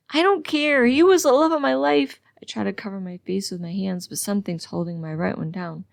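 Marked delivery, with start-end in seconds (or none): 0.1-2.2 high, loud cry of grief; anguished outburst. A narrator's reading. Patient to nurse about the dead lover, anguished yelling.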